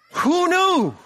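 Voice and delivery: squealed very high and loud; amazed. Acted. The CEO marvelling at the sales in a very high, loud squeal. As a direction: shriek of delighted disbelief.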